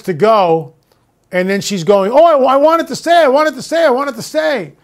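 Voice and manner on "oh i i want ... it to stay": high, pleading, excited repeat; imitating a thrilled witness pleading; excited plea. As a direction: beg it to stay, thrilled and wide-eyed. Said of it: "She's really excited about it".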